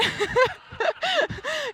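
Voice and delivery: high-pitched giggling; real high laughter; self-amused laugh. Real, not acted. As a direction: laugh at your own performance. Laughs at own dramatized narration.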